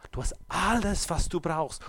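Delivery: ordinary talk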